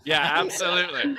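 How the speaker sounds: laughing agreement. Real, not acted. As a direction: chuckle yes.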